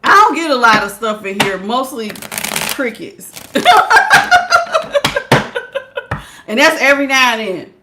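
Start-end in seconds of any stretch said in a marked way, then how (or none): 3.4-6.2 shrieking laugh. Real, not acted. Talking about bugs.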